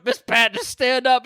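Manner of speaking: hollering it up high in jest; mock helper. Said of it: "He's just standing over you going".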